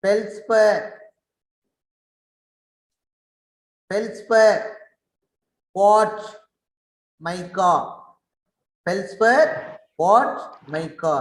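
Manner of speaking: feldspar, quartz, mica called out high and loud, one by one; drill words for class. Shown as a demonstration. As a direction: model each mineral name for the students to repeat. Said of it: "Everyone you should pronounce". (తరగతికి ఒక్కొక్క పదాన్ని పెద్దగా పలికి చూపించే ఉపాధ్యాయుడు)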